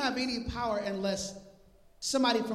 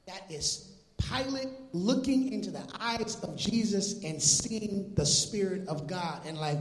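usual speaking voice

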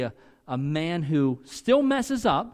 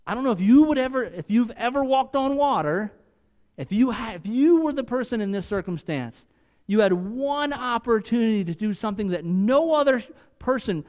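loud, higher fervent preaching; preaching at full force; 7 semitones higher, 4 dB louder than usual. Real, not acted. Preacher stirring the congregation over Peter's one chance, fervent. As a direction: drive it home to the congregation, loud and high.

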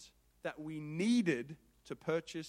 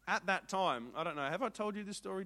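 usual speaking voice

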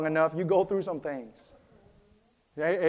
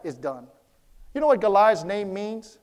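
ordinary talk, nothing marked

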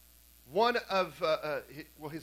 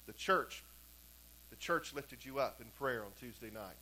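quiet retold answer; 7 semitones lower, 7 dB quieter than usual. Father's answer to weeping daughter.

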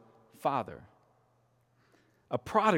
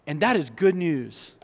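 ordinary delivery

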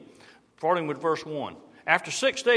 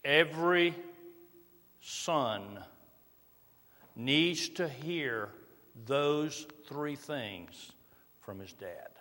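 very slow and much softer, each word laid down; slow right down and let it land; quiet earnest plea; slow, soft emphasis; 4 semitones lower, 6 dB quieter, slower than usual. Real, not acted. Sermon's key point, slowed.

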